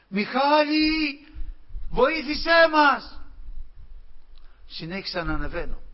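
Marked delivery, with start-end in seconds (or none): 0.1-3.2 high, slow desperate cries from below; voices calling for help. Retold or imitated. Souls below crying out to Michalis for help, high pleading calls. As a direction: call up pleading to be saved. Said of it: "ακούω από κάτω φωνές".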